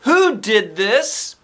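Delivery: slow, loud, high demand; stern teacher catching the culprit. Acted. Teacher demands who did it, slow loud demand. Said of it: "she demanded".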